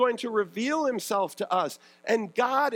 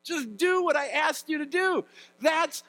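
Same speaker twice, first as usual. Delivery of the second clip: raised, fed-up parent voice near a shout; exasperated parent; 7 semitones higher than usual. Retold or imitated. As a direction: scold the kid who keeps disobeying. Frustrated parent to child.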